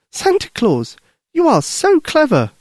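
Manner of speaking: put-on very high, delighted child's voice; admiring little girl. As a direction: gush like a small girl, pitched high. Lucy admires Santa.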